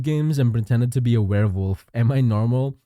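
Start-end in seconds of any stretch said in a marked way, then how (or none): none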